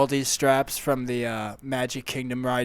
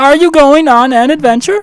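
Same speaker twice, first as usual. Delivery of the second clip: louder, deadpan gas station clerk; loud clerk character; 12 semitones higher, 16 dB louder than usual. Acted. Clerk Steve brags about his name tag and car, loud and deadpan. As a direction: play the earnest oddball attendant.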